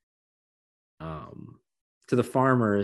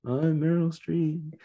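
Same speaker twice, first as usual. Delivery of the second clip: high, slow, drawn-out declaration; hammed-up star announcing herself; mock-grand, stretched-out name drop; Meryl Streep; 7 semitones higher, slower than usual. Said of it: "she has to say that".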